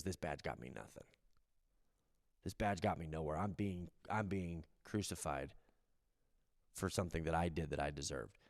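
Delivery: hushed, broken confession from the cross; humbled thief, low and soft. Retold or imitated. Penitent thief to the other thief, low and soft. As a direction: let the voice sink to almost nothing. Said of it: "the other guy goes".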